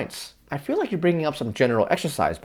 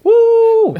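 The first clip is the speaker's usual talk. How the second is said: loud high whoop; excited cheer; 14 dB louder than usual. Real, not acted. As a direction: whoop with joy. Cheering for afternoon Hampton.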